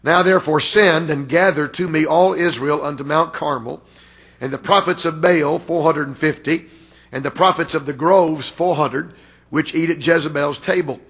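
usual speaking voice

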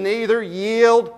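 "yield" slowed right down and leaned on, falling; stress on yield. Shown as a demonstration. Preacher making the church mark the word, slow stress on yield. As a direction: draw out "yield" so they underline it. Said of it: "circle, underline yield".

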